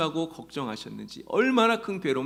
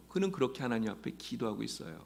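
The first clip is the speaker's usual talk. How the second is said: ordinary delivery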